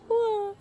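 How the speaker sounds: high imitated crying wail; mock child's wail. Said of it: "He's just crying".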